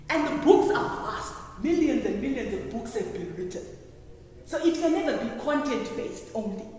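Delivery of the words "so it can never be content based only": ordinary talk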